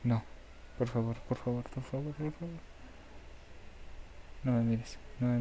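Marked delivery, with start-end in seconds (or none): 0.0-0.2 one long run of no's climbing in pitch; panicked rapid-fire refusal; rising panic. Real, not acted. Monster moves toward the player. 0.8-2.6 frightened pleading. Real, not acted. Begging as the monster nears.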